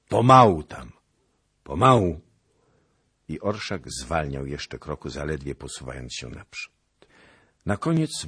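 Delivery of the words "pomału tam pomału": loud call back down the line. A narrator's reading. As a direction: hold them back with a call. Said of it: "jeden z nich wołał".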